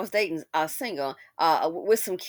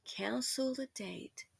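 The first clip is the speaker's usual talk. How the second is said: whisper; 3 semitones higher, 12 dB quieter, slower than usual. Retold or imitated. Reporting the woman's cancellation in a whisper.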